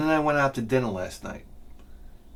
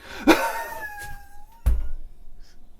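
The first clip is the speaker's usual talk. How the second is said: high shrieking laugh; 4 dB quieter than usual. Real, not acted. Big laugh after punchline.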